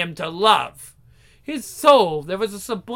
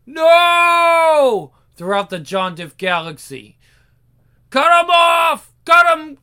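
higher, louder frenzied chanting; mob shouting; 6 semitones higher, 7 dB louder, slower than usual. Acted. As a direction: scream it like a lynch mob. Mob screams for punishment.

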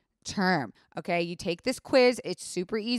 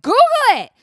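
sudden high shout, much louder; mock yell; 11 dB louder, much faster than usual. Telling boyfriend to just search it, loud high yell. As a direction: bark the order at him, way up high. Snapping at Jared in story.

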